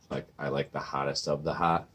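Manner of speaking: ordinary delivery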